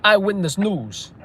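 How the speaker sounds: nostalgic Brooklyn wise guy; put-on Brooklyn accent. Stringer recalling respect back then, put-on Brooklyn accent. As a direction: lament the old days in Brooklynese. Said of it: "Brooklyn accent".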